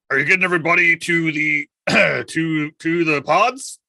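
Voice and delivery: loud, put-on gruff captain voice. Impostor posing as captain to the crew, gruff captain voice. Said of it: "put on my best gruff captain voice".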